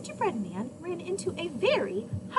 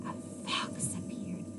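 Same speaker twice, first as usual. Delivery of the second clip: suspense whisper; 7 semitones higher, 7 dB quieter, slower than usual. A narrator's reading. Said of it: "suspense for children".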